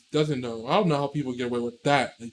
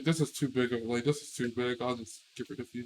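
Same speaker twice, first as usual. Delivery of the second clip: low, very soft mock aside; muttered brush-off; 4 semitones lower, 14 dB quieter than usual. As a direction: dismiss her quietly, as if thinking aloud. Acting out cheater brushing her off.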